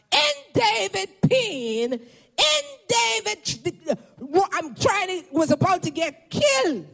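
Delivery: high, drawn-out sermon call; slow, high preaching cadence; preacher's cry. Acted. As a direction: stretch it out like a pulpit proclamation. Preaches at full force.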